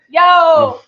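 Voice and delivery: loud high call; hailing a taxi. Pretend. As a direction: call out to a cab.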